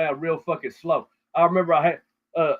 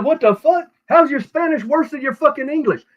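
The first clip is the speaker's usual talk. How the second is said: incredulous outburst; 11 semitones higher, 4 dB louder than usual. Retold or imitated. Retold exasperated reply to painter.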